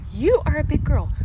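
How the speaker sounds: mother refusing to help her daughter; stern parent. Acted. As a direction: lay down the law to a toddler. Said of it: "I could have said".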